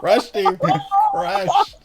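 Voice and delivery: high-pitched gleeful squeal; excited squeal. Real, not acted. Friend reacts to Bill Murray getting crushed, squealing with glee. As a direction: relish the crushing dunk, squealing.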